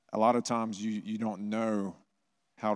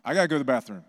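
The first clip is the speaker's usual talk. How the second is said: put-on louder, flatter kid demand, falling; insistent kid; 4 semitones higher, 6 dB louder, much faster than usual. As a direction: another kid pushing to leave, a touch louder. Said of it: "Three more hands went up".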